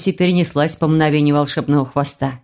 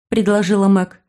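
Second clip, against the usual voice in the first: plain reading voice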